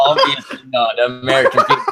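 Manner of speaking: shouting through a laugh. Real, not acted. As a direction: yell it while cracking up.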